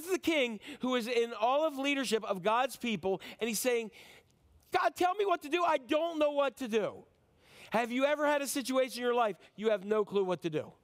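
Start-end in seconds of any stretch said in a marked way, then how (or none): 4.7-7.0 high-pitched acted cry for help; implore, utterly at a loss; hammed-up helpless prayer; desperate pleading. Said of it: "He's pleading".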